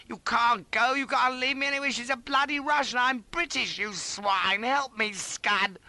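high, loud frantic wail; desperate squealing protest; panicked squeal. A narrator's reading. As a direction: plead and curse as help leaves. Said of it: "I squealed feebly".

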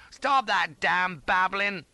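loud, high, slow command; stern rebuke. A narrator's reading. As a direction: sternly silence him, duty personified. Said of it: "stern duty personified".

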